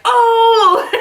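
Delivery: high, loud shocked oh then a laugh; mock crowd gasp. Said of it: "everybody was like".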